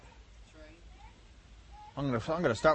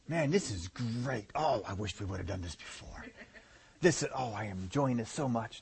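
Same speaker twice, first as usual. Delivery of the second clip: much softer, lower mock-blissful body; sarcastic delight; 6 semitones lower, 10 dB quieter than usual. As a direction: murmur fake contentment, dripping irony. Body mock-enjoying new diet.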